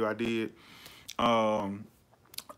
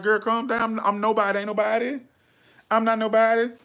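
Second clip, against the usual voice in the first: put-on higher, soothing "girl, calm down" voice; reassuring a fan; 9 semitones higher, 3 dB louder than usual. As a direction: settle her down kindly, a bit higher. Calming an overexcited fan.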